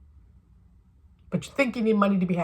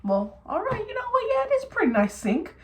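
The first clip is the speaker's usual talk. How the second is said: much higher, softer coy voice of a flattered dad; flattered installer; 9 semitones higher, 4 dB quieter than usual. Retold or imitated. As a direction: play someone won over by praise, bashfully proud. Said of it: "makes people feel like".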